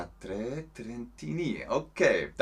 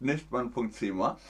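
ordinary delivery

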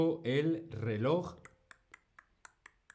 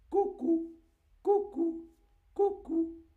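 very high cuckoo "cucú"; cuckoo call; 4 dB louder, slower than usual. Shown as a demonstration. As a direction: chirp "cucú" like a clock bird. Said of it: "el pájaro que sale y dice". (imitando el cucú muy agudo)

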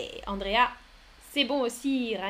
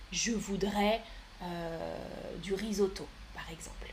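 ordinary talk, nothing marked

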